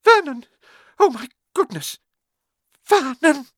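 very high, choked exclamations; shocked cry. A narrator's reading. Aunt reading the letter to her husband, shocked cries. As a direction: cry out as if about to faint.

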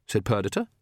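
usual speaking voice

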